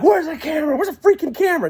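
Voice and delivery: high, urgent retold cry; frantic demand. Passenger hunting for a camera under the lights, frantic.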